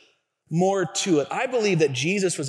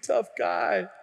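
normal talking voice

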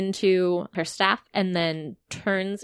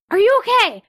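character voice; 12 semitones higher, 6 dB louder, much faster than usual. Acted. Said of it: "Emmy's like".

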